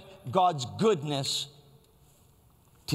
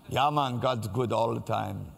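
put-on lightly done Jamaican accent, lower; a hint of Jamaican lilt on the phrase; 5 semitones lower than usual. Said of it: "it sounds really good with the Jamaican accent".

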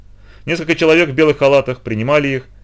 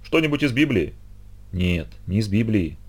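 hushed night talk; 7 dB quieter than usual. Acted.

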